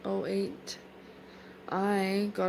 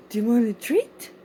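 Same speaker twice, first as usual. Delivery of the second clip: louder, eager treat offer; 9 dB louder than usual. Real, not acted. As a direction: get the dog excited for a treat.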